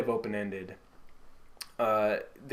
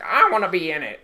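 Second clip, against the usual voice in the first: David Lynch impression; 6 semitones higher, 10 dB louder, faster than usual. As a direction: do Lynch's nasal-free old-timey FBI voice. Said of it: "that's exactly how he sounds".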